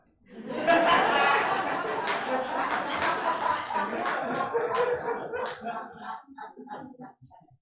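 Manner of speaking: long, loud burst of airy laughter; a loud, high cackle at the memory; big laugh. Real, not acted. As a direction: break up laughing helplessly. Laughs after ugly cry remark.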